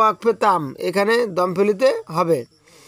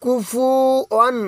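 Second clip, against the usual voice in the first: কুফুয়ান drawn out slowly and a little higher; slow recitation; 4 semitones higher, much slower than usual. Shown as a demonstration. Teacher reciting the word slowly for learners. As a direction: ease through কুফুয়ান slowly. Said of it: "উচ্চারণ দিয়ে পড়ব".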